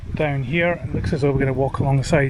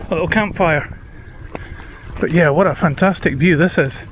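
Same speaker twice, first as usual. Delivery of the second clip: ordinary talk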